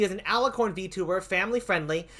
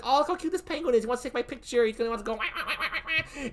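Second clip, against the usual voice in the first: put-on higher, fast, gushing baby talk; cooing alien; 6 semitones higher, faster than usual. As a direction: coo like an alien smitten with a cute penguin. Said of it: "they're gonna be like".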